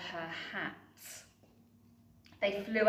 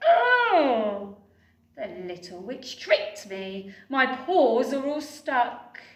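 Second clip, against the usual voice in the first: crying dragon; 3 semitones higher, 8 dB louder than usual. Acted. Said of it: "the dragon ... cried".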